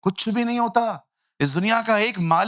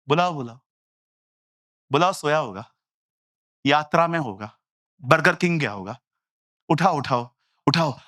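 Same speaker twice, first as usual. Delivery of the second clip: lower, relaxed, taunting tone; calm mocking Elijah; 4 semitones lower than usual. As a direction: tease them calmly, low voice. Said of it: "और एलिया आराम से". (नीची, आराम से चिढ़ाती आवाज़)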